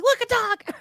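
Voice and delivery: high, delighted look a dog; excited dog spotter. Retold or imitated. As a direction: blurt it out, thrilled. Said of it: "but he's like".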